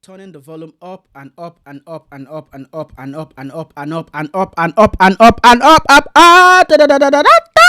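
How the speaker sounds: building from normal to loud distorted clipping; clipping distortion. Shown as a demonstration. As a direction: push the mic until it clips. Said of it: "test how this behaves with clipping audio".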